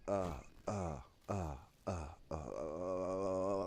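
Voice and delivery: repeated dazed "uh" grunts running together; imitated nitrous stupor noises. Pugsley reacting to his first balloon, dazed droning. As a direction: stammer uhs into a long wobbling drone. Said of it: "starts going".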